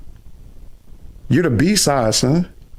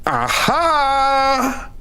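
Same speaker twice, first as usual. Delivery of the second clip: gloating aha; 9 semitones higher than usual. Real, not acted. Catching opponent out, gloating.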